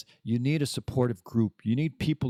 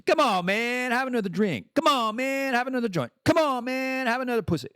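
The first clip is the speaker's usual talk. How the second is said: mock tempter egging on; pushy buddy; 11 semitones higher than usual. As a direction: needle them up high like a pushy friend. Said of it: "people around you say".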